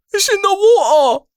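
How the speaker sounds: high, exaggerated British "It's in the water"; British accent. Mimicking the British "It's in the water" accent.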